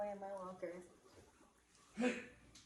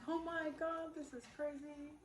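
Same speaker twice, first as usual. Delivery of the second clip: high-pitched retold astonishment; high, mildly hammed-up disbelief; imitating freaked-out friend; 8 semitones higher than usual. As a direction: play her freak-out in a higher voice. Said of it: "and she freaked out".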